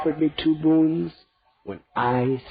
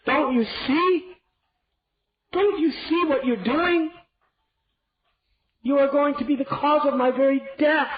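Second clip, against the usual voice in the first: much higher, crying out in anguish; anguished outrage; 13 semitones higher, 4 dB louder than usual. Acted. Grieving king denouncing his cruel queen. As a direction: accuse her with a breaking heart. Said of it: "He spoke".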